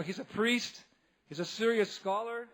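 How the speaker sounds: ordinary talk, nothing marked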